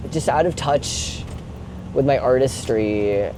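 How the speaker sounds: ordinary talk, nothing marked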